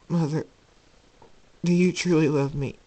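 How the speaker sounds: high, urgent question; pleading son. Acted. Jacob doubting his mother's love, urgently pleading. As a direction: plead for reassurance.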